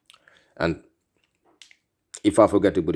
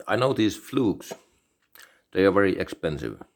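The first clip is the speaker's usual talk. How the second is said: normal talking voice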